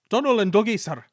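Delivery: put-on Scottish twins naming themselves to the Controller in Scottish accent. A narrator's reading. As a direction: answer brightly in Scots.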